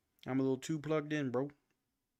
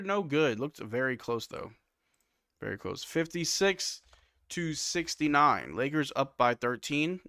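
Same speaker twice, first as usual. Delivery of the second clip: brisk live game call; play-by-play; 3 semitones higher, 4 dB louder than usual. Acted. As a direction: call the action as it happens. Play-by-play continues.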